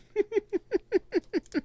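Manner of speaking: sudden squeal of a laugh; high burst of laughter. Real, not acted. Player laughing at her own joke, high laugh.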